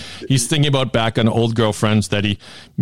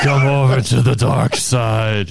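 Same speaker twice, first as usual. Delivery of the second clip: slow, menacing emperor voice; cold rasping evil emperor; slower than usual. Retold or imitated. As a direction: draw it out like a sinister Sith lord. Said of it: "What was it the Emperor said to Luke".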